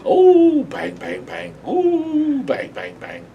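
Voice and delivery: high yelping "Oh" between flat bangs; yelping cartoon dog. Cartoon dog yelping as he whacks himself, high sharp cries. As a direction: yelp each Oh like a dog being smacked, then drop for the bangs.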